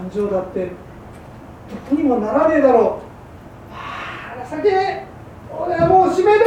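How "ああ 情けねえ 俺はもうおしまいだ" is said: despairing. Acted. Husband in despair.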